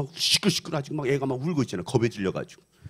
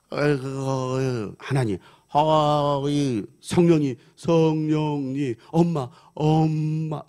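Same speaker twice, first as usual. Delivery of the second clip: very slow, laboured, indistinct syllables; drawn-out groaning attempts at speech; deaf-mute child's first words; 4 dB louder, much slower than usual. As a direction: imitate a child struggling to form words. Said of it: "발음이 또박또박해지는 거야".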